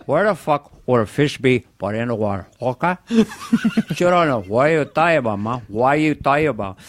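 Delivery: put-on angry Tony Montana Cuban accent; scoffing in a Cuban accent; Scarface Cuban accent. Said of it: "What if Scarface met Robert Shaw".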